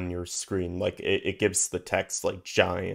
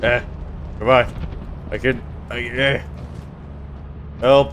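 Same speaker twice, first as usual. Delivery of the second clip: loud cry for help; panicked yelling; 5 semitones higher, 8 dB louder than usual. Real, not acted. Player dying in game.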